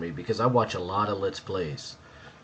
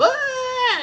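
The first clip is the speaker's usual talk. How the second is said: very high held shriek; mock scream; 4 dB louder than usual. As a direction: imitate a woman shrieking in horror. Imagined woman screams and runs off.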